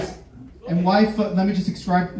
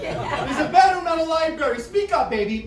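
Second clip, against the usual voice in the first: exasperated scolding; 11 semitones higher, 4 dB quieter than usual. Retold or imitated. Scolding the whispering partner.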